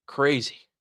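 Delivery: normal talking voice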